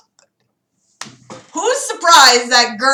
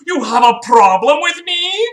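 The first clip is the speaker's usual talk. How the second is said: a hint of a taunting bully, rising at the end; mock bully taunt; 6 semitones higher, 4 dB louder than usual. Reading Philip's taunt as a mock bully. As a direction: jab it like a schoolyard tough. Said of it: "Philip kept interrupting with statements like".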